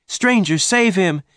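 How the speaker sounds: very high, urgent plea; crying out. A narrator's reading. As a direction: cry out for the stranger's help. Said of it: "Jane cried".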